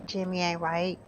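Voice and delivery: quiet, understated remark; low-key disapproval. Real, not acted. Gamer judges Jimmy for leaving, quiet disapproval. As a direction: say it softly, almost to yourself. First take, muttered aside.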